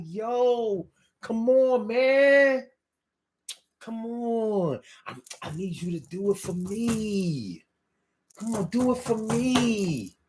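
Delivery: high, dragged-out Philly pleading; Philly whine. Shown as a demonstration. Host demonstrating the Philly staple, pleading drawl. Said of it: "I can't talk like that".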